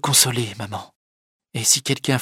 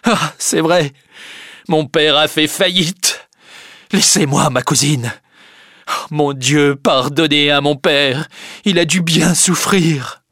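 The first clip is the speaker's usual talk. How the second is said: loud, raised, grief-stricken outburst; anguished lament; 3 semitones higher, 5 dB louder than usual. A narrator's reading. Charles grieves over his bankrupt father, anguished lament. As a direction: lament it loudly, drawing out the pain.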